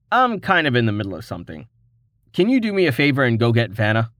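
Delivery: quick, rushed request; brushing her off to get back to business; fast, distracted asking; hurried. Rain hurrying her away.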